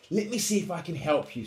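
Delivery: high, loud helpful voice; little mouse. A narrator's reading. Mouse offering Little Lion help, high mouse voice. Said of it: "said Mouse".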